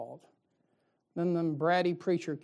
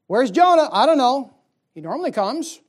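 higher, louder imitated church chatter; loud mock churchgoers; 6 semitones higher, 14 dB louder than usual. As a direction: call out like puzzled members. Church members asking about Jonah.

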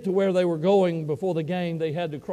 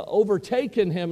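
ordinary talk, nothing marked

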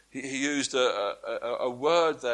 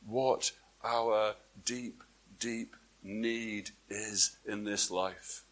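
slow, softer and lower; solemn hush; 5 semitones lower, 8 dB quieter, slower than usual. Acted. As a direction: draw them in quietly.

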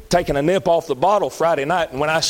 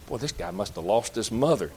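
mild onlooker murmur; 6 semitones lower, 8 dB quieter than usual. Retold or imitated. Onlooker guessing at tears.

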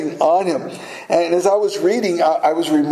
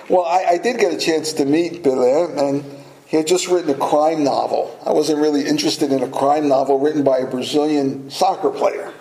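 usual speaking voice

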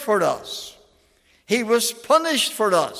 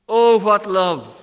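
ordinary delivery

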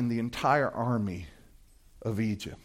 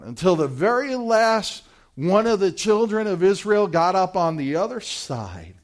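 ordinary talk, nothing marked

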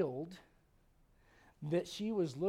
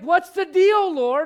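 loud, high confrontational question; mock-outraged shout; indignant demand; 13 semitones higher, 19 dB louder, slower than usual. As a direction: Martha challenging the Lord. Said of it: "Martha's like".

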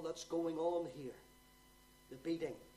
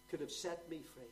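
ordinary talk

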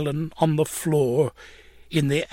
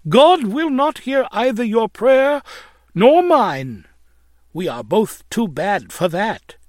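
on the verge of weeping; 6 semitones higher, 4 dB louder than usual. A narrator's reading. Landlady answering her lodger, mildly acted despair before weeping. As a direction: start loud and high, then let it fall away. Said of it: "bursting out weeping".

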